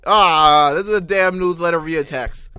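loud exasperated outburst; loud outraged. Real, not acted. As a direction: shout it in disgust. Outraged at the newsletter scam.

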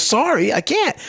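high-pitched stand-up delivery, imitated; retold comedian. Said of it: "the man goes".

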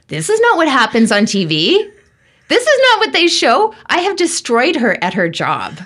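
very high, loud mock wail; aghast, dramatised cry of regret; horrified panic. Medium realising she upset an attendant at work, horrified panic. Retold horror at making woman cry.

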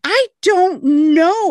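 high, slow, loud bewildered cry; baffled outburst; exasperated. Real, not acted.

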